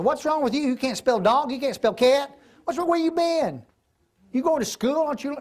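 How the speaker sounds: mock scolding. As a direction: needle a little sibling with pushy questions. Mocking a younger sibling's spelling, acted.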